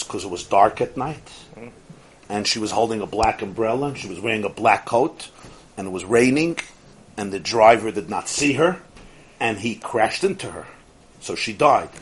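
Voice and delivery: low, subdued literal answer; low-pitched, detached account; matter-of-fact explanation. Acted. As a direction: recite the facts quietly, without feeling. Acted literal answer to grieving man.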